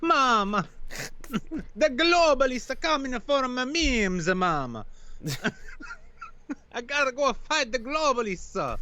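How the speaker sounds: high, pleading mock Italian accent; mock Italian son. Son begs mama to let him fight, hammed-up Italian accent.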